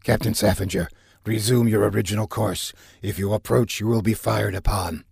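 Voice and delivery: measured radio warning; overhead speaker voice. A narrator's reading. Human controller warning the captain, over the speakers. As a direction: warn formally over a crackly intercom. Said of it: "A human voice came on the overheads".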